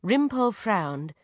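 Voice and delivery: ordinary delivery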